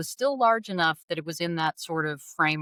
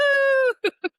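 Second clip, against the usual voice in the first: high shrieking laugh; 7 dB louder than usual. Real, not acted. Laugh after the whoop.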